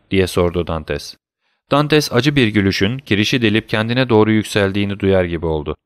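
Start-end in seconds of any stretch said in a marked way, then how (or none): none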